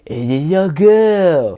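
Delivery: slow, over-the-top French accent like a cartoon candle; exaggerated French accent of the candle Lumiere; hammy French accent. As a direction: ham up a fake French accent, slowly. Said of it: "too hammy and too over the top and too cartoony".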